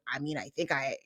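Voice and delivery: a hint of a low, sheepish husband voice; lightly voiced man, dipping low and hedging. Mimicking the husband defending himself, low and hedging. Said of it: "And he's like".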